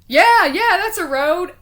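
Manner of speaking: loud, high mock assurance; imitated eager reply. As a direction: act out an overeager yes. Acting out companion's eager reply.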